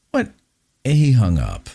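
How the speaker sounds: usual speaking voice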